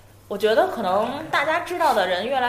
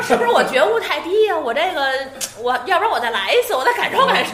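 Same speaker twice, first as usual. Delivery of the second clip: laughing quote; 6 semitones higher, 5 dB louder than usual. Retold or imitated. Girl quoted, laughing retelling.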